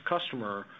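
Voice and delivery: usual speaking voice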